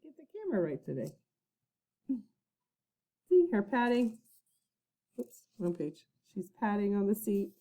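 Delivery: hushed off-mic chatter; muttered aside. Real, not acted. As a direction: drop out of the story to fix things. Aside to off-camera.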